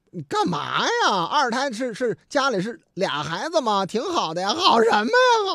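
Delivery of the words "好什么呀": high, loud exasperated cry; mock outburst. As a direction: blurt it out as the fed-up father. Drunk father complains to police. (父亲对警察抱怨，高声嚷)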